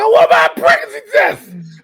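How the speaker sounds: high, loud excited yell; demanding shout. Retold or imitated. Comedian reliving his shopping obsession, high demanding shout. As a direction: blurt it at full volume.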